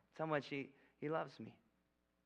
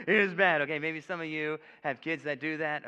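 loud, laughing through the words; 4 semitones higher, 14 dB louder than usual. Real, not acted. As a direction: laugh it out at the memory. Laughing at his childhood escape.

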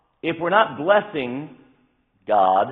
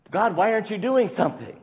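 higher, exasperated plea; mock complaint to God; 6 semitones higher than usual. People tempted by society and politics question God, exasperated. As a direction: plead as if let down by God.

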